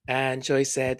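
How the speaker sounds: normal talking voice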